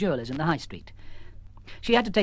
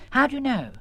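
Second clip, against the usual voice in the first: higher, surprised question; 9 semitones higher than usual. Acted. Peter Stone asking the sergeant, surprised. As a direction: ask in quick surprise.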